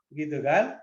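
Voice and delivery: ordinary talk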